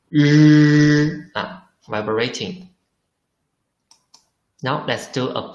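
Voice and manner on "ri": ri said louder with the voice buzzing; voiced ri demo. Shown as a demonstration. Teacher demonstrates voiced ri for students to feel, louder. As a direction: make ri buzz in the throat. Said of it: "you can feel".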